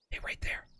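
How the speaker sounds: breathless whisper. Real, not acted. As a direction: whisper so the animal won't hear. Hunter steadying for shot.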